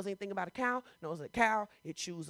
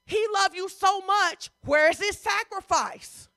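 challenging preaching; 8 semitones higher, 8 dB louder than usual. Real, not acted. Preacher asking believers where their sacrifice is, impassioned challenge. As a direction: press the question home at a high pitch.

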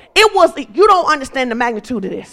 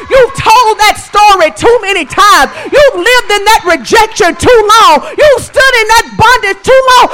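high, insistent, repeated challenges; full-force preaching; 8 semitones higher, 6 dB louder than usual. Real, not acted. Preacher challenging weary listeners, impassioned. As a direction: press the congregation question after question.

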